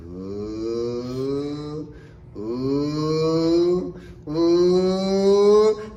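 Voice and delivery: long "ooh" climbing in pitch and volume; rising floating whoop. Acted. Sound effect for floating upward.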